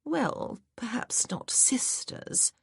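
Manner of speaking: whispered, slow aside; coy whisper. Acted. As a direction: breathe it out slyly. Coy aside by Miss Hargrove.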